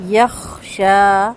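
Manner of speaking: یخشا slow, long aa stretched; drawn-out yakhsha. Shown as a demonstration. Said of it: "کھڑا زبر جب بھی ہوتا ہے". (یخشا لمبا کھینچ کر)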